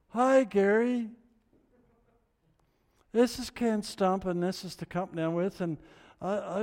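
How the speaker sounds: high, halting phone greeting, hammed-up; awkward nervous caller. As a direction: stall nervously, then force the question out high and loud. Acts out his awkward phone call.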